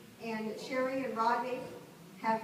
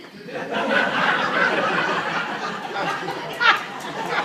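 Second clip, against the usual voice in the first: big real laugh, much louder; loud burst of laughter; 11 semitones higher, 14 dB louder than usual. Real, not acted. Remembering the youth choir song, laughing loud. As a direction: laugh out hard at the memory.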